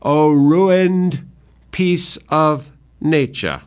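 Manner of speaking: blinded father mourning the mad king; despairing cry. Acted. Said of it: "cries out".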